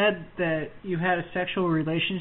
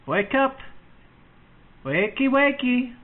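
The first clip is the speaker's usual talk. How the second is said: loud wakey wakey; 7 semitones higher, 5 dB louder than usual. Pretend. Mock wake-up call.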